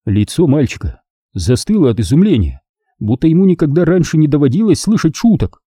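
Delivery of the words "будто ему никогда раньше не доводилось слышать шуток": ordinary delivery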